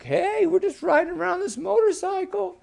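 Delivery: high-pitched eager frog voice; squeaky frogs showing off; excited boy frogs. Acted. Boy frogs answering the girl, high and eager.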